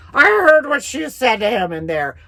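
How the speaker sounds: lightly voiced accusing mother, a touch sharp. A hint of the mother accusing her daughter.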